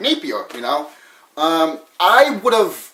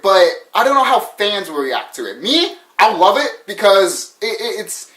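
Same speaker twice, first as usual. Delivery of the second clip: normal talking voice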